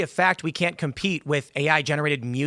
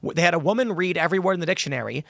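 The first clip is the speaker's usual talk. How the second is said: ordinary delivery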